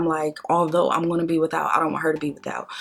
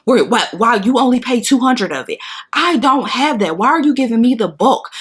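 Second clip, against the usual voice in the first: exasperated protest, higher and louder; retold cussing out; 5 semitones higher, 4 dB louder than usual. Arguing with daycare lady over the bill, exasperated. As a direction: plead your case angrily, pitched up and forceful. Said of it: "I remember cussing that lady out".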